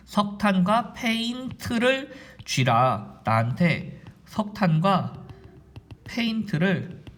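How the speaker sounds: slow, stretched words with pauses; slow dictation. Shown as a demonstration. Teacher spelling out a memory phrase to students, slowly and deliberately. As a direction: draw out each chunk of the phrase.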